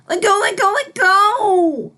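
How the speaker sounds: high, loud repeated shriek; frantic yelling. Acted. Filly shrieking at the fish to let go. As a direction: yell it over and over, desperate.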